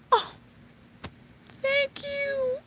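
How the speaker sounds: very high, soft, near tears; moved thanks. Real, not acted. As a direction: choke it out touched. Moved by viewers' support.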